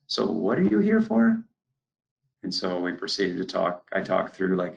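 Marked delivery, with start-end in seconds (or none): 0.1-1.4 farmer's question pitched far higher; gruff farmer. Retold or imitated. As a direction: voice the farmer asking, tone high. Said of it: "And then he goes".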